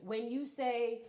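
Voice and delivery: ordinary delivery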